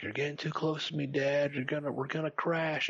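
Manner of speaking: put-on high worried girl voice; daughter voice. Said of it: "Leah's like".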